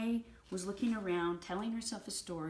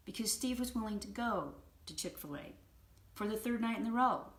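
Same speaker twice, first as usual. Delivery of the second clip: ordinary talk, nothing marked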